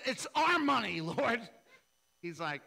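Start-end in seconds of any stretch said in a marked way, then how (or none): 0.1-1.4 high, loud hurried backtrack; hammed-up nervous correction; sheepish loud correction. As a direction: blurt it out caught red-handed. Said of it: "I said".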